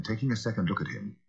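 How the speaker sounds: ordinary delivery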